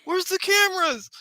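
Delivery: high-pitched, loud disbelieving cry; amazed onlooker. Acted. Game master voices onlookers who think it's a movie, amazed. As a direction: exclaim like a stunned bystander, high and loud.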